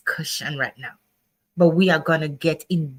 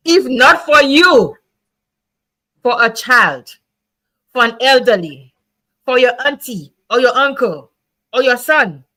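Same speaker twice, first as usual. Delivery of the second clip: impassioned plea; 4 semitones higher, 5 dB louder than usual. Real, not acted. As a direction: plead loudly with the audience.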